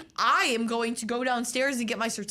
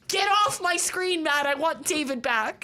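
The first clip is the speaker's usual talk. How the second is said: higher, louder mock-annoyed plea; mock complaint; 5 semitones higher, 3 dB louder than usual. Fan scolding the new Doctor on screen, mock-annoyed. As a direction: whine at the screen to bring David back. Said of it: "And it's like".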